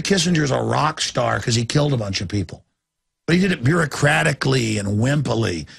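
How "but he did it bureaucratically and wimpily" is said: ordinary talk, nothing marked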